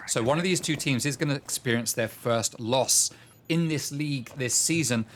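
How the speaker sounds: energetic esports caster calling the action; brisk match commentary; live play-by-play. Acted. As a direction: call the round as it unfolds. Caster calls the round.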